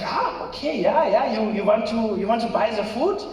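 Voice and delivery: put-on high, hesitant German accent; puzzled reply in a German accent; Rolf. German friend asked about ordering food, German accent.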